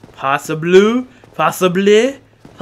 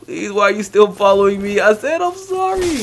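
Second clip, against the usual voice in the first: high, loud frantic pleading; frantic apologies; 7 semitones higher, 6 dB louder than usual. Real, not acted. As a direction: beg to be spared, high and loud. Begging attacker to stop hitting.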